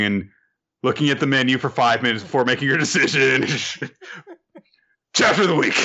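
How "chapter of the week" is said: mock announcer hype. Mock award for dull chapter.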